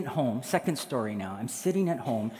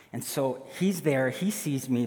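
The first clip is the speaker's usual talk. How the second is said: usual speaking voice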